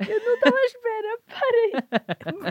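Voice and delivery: high, loud, tearful laughter through the words; crying with laughter. Real, not acted. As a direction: beg them to stop while laughing to tears. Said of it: "eu tô a chorar".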